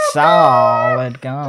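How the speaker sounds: drawn out, loud and carried away; very slow, booming cartoon exclamation; grooving film character. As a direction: stretch it out like you're lost in the beat. Acting bear lost in the music.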